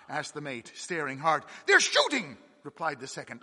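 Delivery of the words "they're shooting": high, loud alarmed cry. Acted. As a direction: call it out in shock. Second mate sees gunfire.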